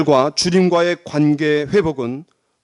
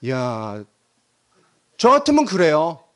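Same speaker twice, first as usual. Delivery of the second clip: usual speaking voice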